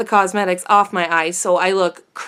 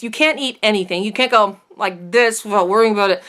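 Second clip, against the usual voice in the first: ordinary talk, nothing marked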